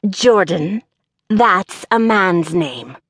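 slow, over-enunciated gravelly character voice; peculiar gravel voice. A narrator's reading. Employer objects to the applicant's name, peculiar gravelly voice. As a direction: stretch out each word, gravelly and disapproving. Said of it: "enunciated in a peculiar voice".

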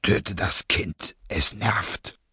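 low, slow, indifferent order; cold masked villain. Acted. Masked leader orders subordinate to kill baby, low and cold.

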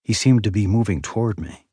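plain reading voice

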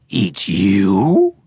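low, odd creature voice, lightly surprised; a touch of an offended creature; startled creature exclamation. Acted. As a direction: recoil at the idea. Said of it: "Gilly exclaimed".

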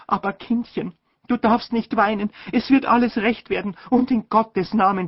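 calm a crying daughter, distressed; unhappy mother. A narrator's reading. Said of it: "ganz unglücklich geworden und hat gerufen".

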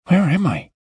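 put-on high, dazed young boy waking up; a bewildered teen coming to; groggy boy. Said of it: "Pete blinked groggily".

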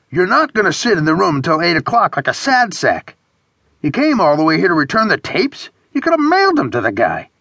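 high-pitched, incredulous scolding; exasperated friend. A narrator's reading. Friend berating the sad sack over tapes, exasperated.

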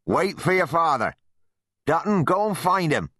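high, urgent calling voice; shouted orders. A narrator's reading. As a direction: call out orders across a field. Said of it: "shouted Wat Harelip".

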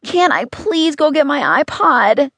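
put-on high, slow child's whine; drawn-out, high-pitched begging. A narrator's reading. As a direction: drag out the plea in a high kid voice. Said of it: "Henry begged".

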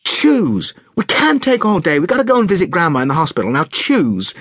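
pressed and running out of patience; impatient mother. A narrator's reading. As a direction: hurry the child along, mildly cross. Mother losing patience, hurrying child.

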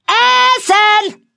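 very high, loud drawn-out yell; shouted dinner call. A narrator's reading. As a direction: holler it out of the window to the boys. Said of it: "schrie sie".

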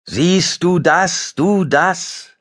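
like the mountains calling it back; echo. Acted. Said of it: "hallte es".

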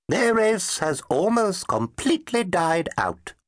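high, slow, saddened twitter; sad old professor. A narrator's reading. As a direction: let the bad news sink slowly. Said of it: "Sog nodded sadly".

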